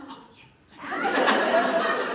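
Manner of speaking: high, loud laughing oh yeah; sheepish realisation. Retold or imitated. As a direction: squeal the realisation through a laugh.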